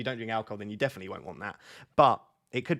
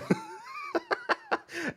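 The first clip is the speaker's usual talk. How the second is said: burst of laughter; 4 dB quieter than usual. Real, not acted. Girlfriend mocking, drink opened.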